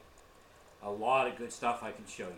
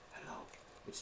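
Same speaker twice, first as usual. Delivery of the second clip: faint spirit hello; 16 dB quieter, faster than usual. Retold or imitated. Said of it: "it'd be like".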